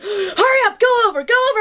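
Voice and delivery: high, urgent mock yell; imitated screaming. DP hurrying his driver over, high mock scream. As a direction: hound the driver in a screamed impression. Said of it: "he's just screaming at me".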